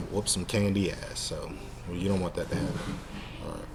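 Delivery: usual speaking voice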